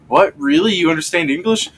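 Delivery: high-pitched astonished questions; mock surprise. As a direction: imitate someone caught off guard, pitch jumping up. Retold Family Guy surprise at English.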